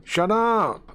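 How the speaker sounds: sudden high yelled shut up; outburst. Acted. A spooked coworker snaps at the office, sudden yell. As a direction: snap it out like a cornered man.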